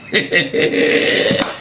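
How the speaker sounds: long cackle at the joke; mocking laugh. Real, not acted. Vlogger laughing at men chasing girls, mocking. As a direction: cackle away at their folly. Laughs at his own taunt.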